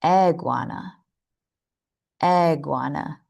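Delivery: "Iguana" opened on the E of "egg"; incorrect E. Shown as a demonstration. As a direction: say "Iguana" starting with eh instead of ih. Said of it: "saying it incorrectly with an E instead".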